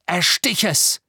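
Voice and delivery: loud, high urgent command; brusque. A narrator's reading. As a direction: order him harshly to strike. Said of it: "sagte Harry barsch".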